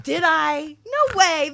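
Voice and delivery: loud, high protest; mock disbelief. Passenger hears they hurt a star rating, loud mock disbelief. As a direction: protest loudly as if scandalized.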